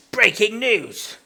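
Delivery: slow, loud, high newsreader; mock news announcer. Teasing friend with a hammed-up breaking news announcement. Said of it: "mock news jingle".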